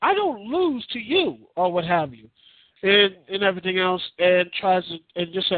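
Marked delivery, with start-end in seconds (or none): 0.0-1.4 higher, slower mock outrage at losing; indignant disbelief, higher and slower; stunned disbelief. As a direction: play a shocked champ who can't believe he lost. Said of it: "And A J just like".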